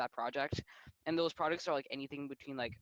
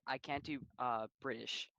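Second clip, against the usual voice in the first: ordinary talk, nothing marked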